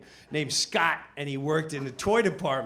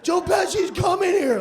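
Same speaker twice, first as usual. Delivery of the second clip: panicked shout; 14 semitones higher, 7 dB louder than usual. Retold or imitated.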